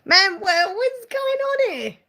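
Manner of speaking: high, slow, startled exclaim; surprised. Real, not acted. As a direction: caught off guard by something on screen. Reacts to a donation alert.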